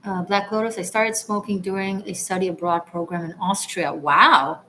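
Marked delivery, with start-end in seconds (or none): none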